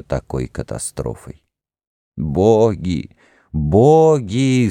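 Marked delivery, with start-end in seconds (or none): 3.5-4.7 moaning cry. Acted. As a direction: cry it out in despair. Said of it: "стонал и плакал народ".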